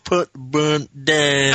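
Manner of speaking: very slow, stretched Cage line; Cage impression. Quoting Con Air as Cage, very slow. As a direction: drag every word like Cage in Con Air. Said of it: "that impression".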